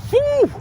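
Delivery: sharp high whew. Real, not acted. Cold outdoors, exhaling.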